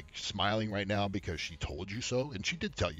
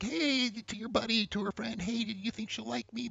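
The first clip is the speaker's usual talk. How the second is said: high, needy whine; whining guy; 10 semitones higher than usual. Acted. Asking a buddy if she likes him, whiny. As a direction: whine it in a small pitched-up mock voice. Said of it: "Instead of whining".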